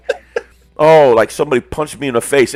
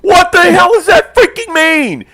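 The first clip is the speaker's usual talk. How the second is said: hammed-up retold yell; imitating the friend; 13 semitones higher than usual. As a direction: impersonate him insisting in exasperation. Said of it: "he goes".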